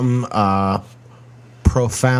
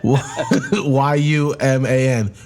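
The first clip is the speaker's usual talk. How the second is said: ordinary delivery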